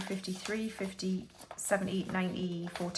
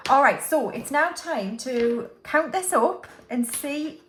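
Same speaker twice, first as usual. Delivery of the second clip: excited announcement; 4 semitones higher, 6 dB louder than usual. Real, not acted. Announcing the big count.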